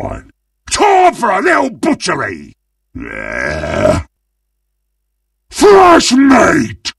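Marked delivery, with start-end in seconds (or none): 5.5-6.9 high, excited shout at fresh prey; gleeful battle cry. Acted. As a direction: yell it like a ravenous butcher.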